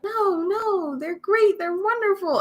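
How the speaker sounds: high-pitched doting insistence; mock doting mom. Imagined mother defending her sassy child, mock doting. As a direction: defend the kid sweetly. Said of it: "she's like".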